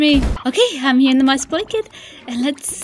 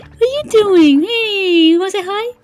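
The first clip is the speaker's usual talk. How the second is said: excited cooing; 7 semitones higher than usual. Real, not acted. Player begging cute creatures to fly, excited cooing. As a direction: squeal to the little creatures in a high voice. Spots and coaxes favorite creatures.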